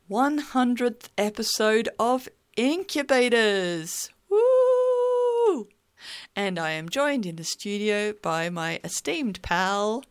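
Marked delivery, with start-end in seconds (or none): 4.3-5.7 high, loud celebratory whoop; excited woo. Real, not acted. Host cheering the hundredth episode, excited whoop.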